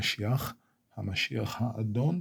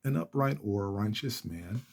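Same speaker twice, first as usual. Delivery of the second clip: usual speaking voice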